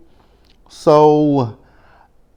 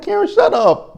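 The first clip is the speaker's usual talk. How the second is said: much higher, louder laughing yell; exasperated shout; 3 dB louder than usual. Retold or imitated. Host yelling at Karen in the video, laughing shout. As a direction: yell it through laughter, begging her to stop.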